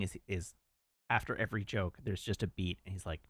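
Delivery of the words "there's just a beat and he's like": normal talking voice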